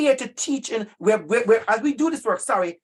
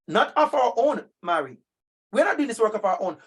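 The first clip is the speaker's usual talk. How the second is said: normal talking voice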